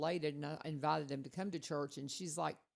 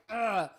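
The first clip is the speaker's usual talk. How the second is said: loud, higher exasperated "ugh"; frustrated groan; 5 semitones higher, 9 dB louder than usual. Retold or imitated. Daughter imitating her frustrated dad, exasperated groan. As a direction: imitate dad's fed-up grunt. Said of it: "so frustrated".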